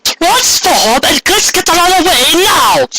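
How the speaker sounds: high, slow, urgent command; rally the girls to transform; cat's order. Acted. The cat to the girls before the attack: high urgent order.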